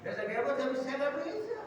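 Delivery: high whining mock refusal; man refusing. Man telling the Prophet he can't, high whiny voice. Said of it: "Orang itu".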